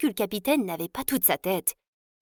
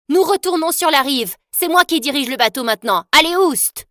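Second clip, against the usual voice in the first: higher, loud captain's orders; loud, high orders barked on deck; bossy command; 5 semitones higher, 6 dB louder than usual. Acted. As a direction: take charge and shoo the captain off. Girl takes command of boat.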